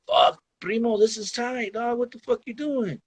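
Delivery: lightly voiced hyped-up homie; amazed friend.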